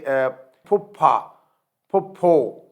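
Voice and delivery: breathy aspirated پھپھا with a puff of air. Shown as a demonstration. Teacher showing Urdu aspirated پھ to students. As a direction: push air out on پھپھا and پھپھو. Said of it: "Urdu میں اس کا equal words جیسے".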